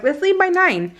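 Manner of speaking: high, mock-cheerful plan; high-voiced mock confidence; breezy agreement. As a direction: chirp it like a carefree promise. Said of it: "We're like".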